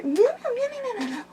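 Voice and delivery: high cat meows; meowing. Real, not acted. Meowing at her cat in a high kitten voice.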